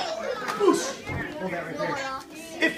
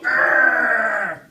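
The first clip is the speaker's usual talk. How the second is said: growl; 2 semitones lower than usual. Shown as a demonstration. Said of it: "look at those guys and go".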